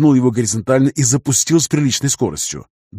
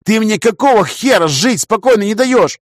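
angry; 10 semitones higher, 5 dB louder than usual. A narrator's reading. Angry retort to the creature.

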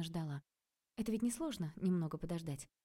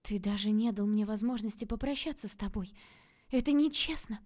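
whispered; 4 semitones higher, 5 dB louder than usual. A narrator's reading. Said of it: "прошептала Таня".

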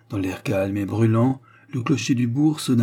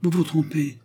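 higher-pitched, quick-tempered contradiction; lively retort; 7 semitones higher than usual. A narrator's reading. Young man defends the girl to professor, lively. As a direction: contradict him briskly. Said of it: "répliqua vivement le jeune homme".

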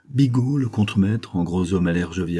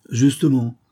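mild moan, higher and louder; 5 semitones higher, 5 dB louder than usual. A narrator's reading. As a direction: lightly groan it out in complaint. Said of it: "gémit le vieux".

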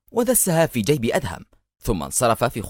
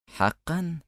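higher, soft sardonic question; mocking amusement; 6 semitones higher, 5 dB quieter, much slower than usual. A narrator's reading. Gunman doubting his victim at the meeting, softly mocking. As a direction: toy with him in one light word. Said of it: "أطلق جلهي ضحكة ساخرة قصيرة وقال".

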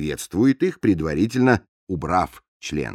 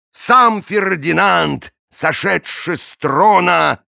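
raised, slow declaiming; lightly voiced grand storyteller; boastful don voice; 8 semitones higher, 3 dB louder, much slower than usual. Declaimed verse continues.

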